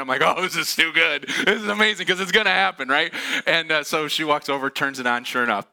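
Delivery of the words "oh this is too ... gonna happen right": gleeful. Retold or imitated. Retelling his glee at the prank.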